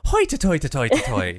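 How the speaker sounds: warm up into an Irish accent; put-on Irish accent, chanted as a practice phrase. Shown as a demonstration. Podcaster shows friends how they get into Irish, Irish accent. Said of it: "how I get into my Irish accent".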